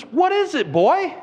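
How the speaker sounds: high-pitched talking-to-a-dog voice; butcher coaxing a dog. Acted. Butcher greeting the Great Dane at the counter, high coaxing voice. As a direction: pitch it up, as to a pet.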